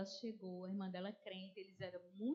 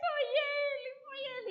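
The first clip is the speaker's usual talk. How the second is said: much higher, breaking into a cry; 8 dB louder than usual. Retold or imitated. Bedridden woman cries out who hurt her, sobbing. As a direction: wail the words through sobs.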